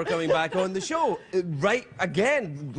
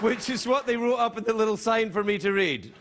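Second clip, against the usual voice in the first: ordinary talk, nothing marked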